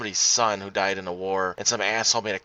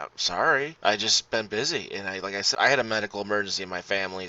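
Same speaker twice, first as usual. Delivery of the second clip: ordinary delivery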